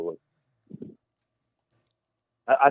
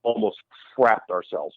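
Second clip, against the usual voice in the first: ordinary delivery, nothing marked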